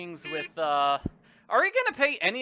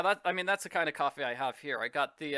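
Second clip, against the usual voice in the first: usual speaking voice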